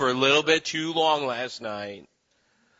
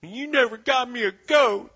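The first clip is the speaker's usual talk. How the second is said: much higher, whining sulky complaint with a laugh; whiny older brother; 13 semitones higher, 3 dB louder than usual. Acted. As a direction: whine it like a spoiled big brother. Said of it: "And whiny older brother says".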